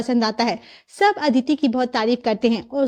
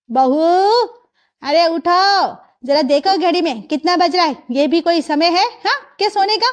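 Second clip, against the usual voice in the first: loud, higher wake-up call; nagging mother-in-law, pitched up; scolding mother-in-law; 4 semitones higher, 3 dB louder than usual. Acted. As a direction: rouse the sleeping bride sternly. Mother-in-law rouses the bride.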